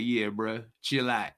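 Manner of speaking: low, soft, easy street talk; a cool brother, deep and quiet; laid-back slang. Retold or imitated. Voicing the chill brother the Lord likes, low and relaxed. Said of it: "no, the Lord like".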